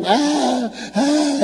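high mock wailing "Ah, ah"; imitating mourners' wailing. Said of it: "and crying and crying and crying".

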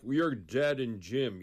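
very slow, drawn-out mock threat; imitating a bully warning him he's finished. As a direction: lean on each word like a schoolyard tough. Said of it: "then they were like".

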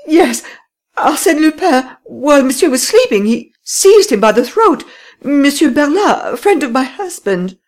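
high, loud tearful wail; weeping. Acted. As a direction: sob the words out in distress. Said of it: "Then she continued weeping".

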